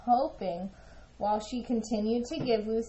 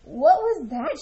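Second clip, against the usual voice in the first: loud, high startled cry; startled shout; 5 semitones higher, 7 dB louder than usual. A narrator's reading. Ava startled by a sudden chirp, surprised shout. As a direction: call out in surprise. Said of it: "she shouted".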